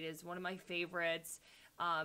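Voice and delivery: ordinary talk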